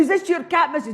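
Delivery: put-on high cheeky kid bargaining; a chancer of a wee boy, pitched high. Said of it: "He says".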